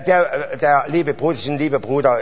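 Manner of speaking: ordinary talk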